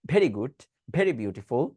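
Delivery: very said as bheri with a puff of air on the bh, slowly; wrong breathy very. Shown as a demonstration. Teacher showing students their wrong bheri for very. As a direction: demo the habitual mistake: very as breathy bheri good, bheri beautiful. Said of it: "যার কারণে আমরা বলে আসি".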